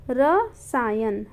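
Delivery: रसायन drawn out very slowly, short र; slow demonstration. Shown as a demonstration. Teacher reminding students of the correct word, very slow. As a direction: stretch रसायन syllable by syllable. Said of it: "रा ना होके".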